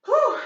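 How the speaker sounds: high sharp whew of relief; winded exhale. Real, not acted. Instructor to class after jumps, winded whew. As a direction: puff out hard after the set.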